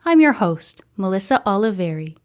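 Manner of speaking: plain reading voice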